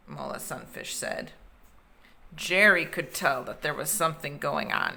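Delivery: plain reading voice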